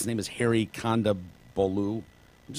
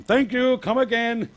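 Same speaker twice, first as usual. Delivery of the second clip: much higher, louder put-on Indian accent on "Thank you. Come again."; mock Apu accent; 12 semitones higher, 5 dB louder than usual. Heckler yelling out the car window in a mock Indian accent. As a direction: sing it out in a high mock Indian shopkeeper voice. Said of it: "he's using the Apu voice".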